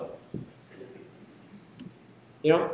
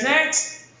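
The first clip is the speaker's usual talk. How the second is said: high, rising "next" to move the line along; brisk server's call; 8 semitones higher than usual. Acted. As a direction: call out for the next customer, pitched up. IT acted as a rushed server.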